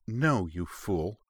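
slow, drawn-out fond exasperation; exasperated. A narrator's reading. Wife answering husband's silly theory, exasperated. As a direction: drag it out at a teasing spouse. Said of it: "Tsering rolled her eyes with an exasperated".

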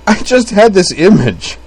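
high, loud, drawn-out gasp; squealed out, barely holding together; gasped through hysterics. A narrator's reading. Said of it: "Utena gasped".

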